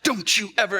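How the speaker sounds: high-pitched mock-mad mom; mad scolding. Mom to her found son, mad and scolding. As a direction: scold like a relieved, cross mother.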